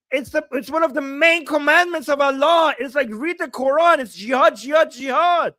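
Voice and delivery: worked-up, indignant tirade; exasperated rant. Real, not acted. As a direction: argue it hotly, voice pushed up. Exasperated rant about jihad.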